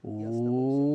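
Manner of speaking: long held "ooh" slowly climbing; sustained pitch glide. Shown as a demonstration. Teacher steering a game airplane by pitch, long rising ooh. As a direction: glide gently upward on one long ooh. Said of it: "Let me try to make that, uh, plane take off".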